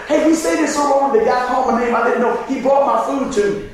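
usual speaking voice

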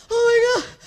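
overexcited shout of a fan; fan freaking out. Retold or imitated. Fan at a stadium cheering, loud excited cry. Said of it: "He's like".

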